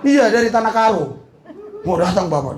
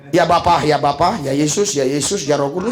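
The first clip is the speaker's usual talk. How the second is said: lower, muttered mock of a rambling prayer; fussy prayer; 8 semitones lower than usual. Preacher mocks a worshipper's over-complicated prayer, low and mild. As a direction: lightly imitate someone listing every name in prayer. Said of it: "Nggak usah".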